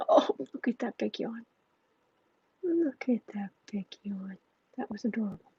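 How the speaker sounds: hushed delight at a pet; soft cooing. Real, not acted. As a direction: coo quietly over the cat. Cooing at cat's yawn.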